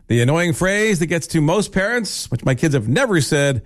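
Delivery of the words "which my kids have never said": usual speaking voice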